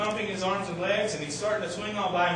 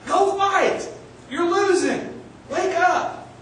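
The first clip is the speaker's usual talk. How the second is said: much higher and louder shouts; corner yelling; 11 semitones higher, 5 dB louder, much slower than usual. Retold or imitated. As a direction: urge him up from the corner. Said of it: "in his corner yelling".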